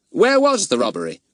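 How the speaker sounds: lightly voiced keen boy; Fatty asking the policeman; eager boy.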